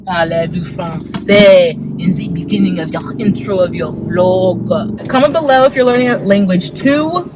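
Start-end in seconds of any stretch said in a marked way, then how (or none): 0.0-5.1 hammed-up French accent, slowing at the end; mock French-accented English; playful French accent. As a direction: put on a French accent and draw out the ending. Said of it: "speaking English in a French accent".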